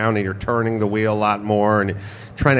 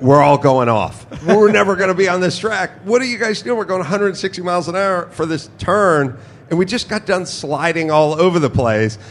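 higher, mock-panicked protest; retold alarm; 7 semitones higher than usual. Racer recalling his thought entering turn eight, mock alarm.

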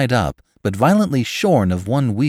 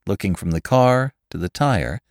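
ordinary delivery, nothing marked

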